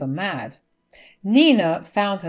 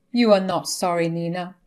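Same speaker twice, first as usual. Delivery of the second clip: ordinary delivery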